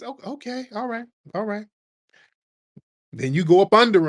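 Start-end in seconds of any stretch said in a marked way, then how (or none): 0.0-1.7 soft, knowing "okay, all right"; sarcastic okay. Retold or imitated. Comedian sarcastically accepting the rival's boast, quiet and knowing.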